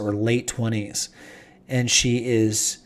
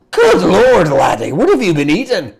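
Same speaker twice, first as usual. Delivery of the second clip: loud, higher exclamation of amazement; astonished old dwarf; 6 semitones higher, 6 dB louder than usual. Acted. The old dwarf gapes at the giant dwarf, loud and high. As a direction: blurt it out in shock.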